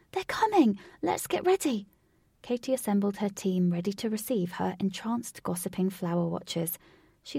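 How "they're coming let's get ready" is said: much higher, excited cry; breathless alarm. A narrator's reading. Katy running back to her team, breathless and excited. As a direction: gasp out the news. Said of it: "gasping for breath".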